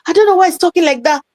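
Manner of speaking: a hint of a Jamaican voice, quick and high; imitating the Jamaican doorman. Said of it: "And the guy was Jamaican".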